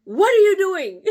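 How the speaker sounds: high loud alarmed question; husband's loud question. Retold or imitated. Husband catching her on the fence, loud and high. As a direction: shout it like a startled husband. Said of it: "he goes".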